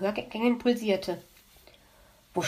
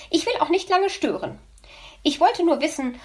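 magpie voice; 6 semitones higher, 4 dB louder than usual. Acted. Magpie on phone, impatient.

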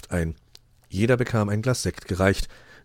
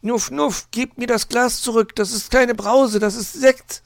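high, loud hedgehog voice; high, alarmed animal mother; snuffling hedgehog mother; 7 dB louder than usual. A narrator's reading. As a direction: scold urgently in a high voice. Mother hedgehog snuffles, scolds children.

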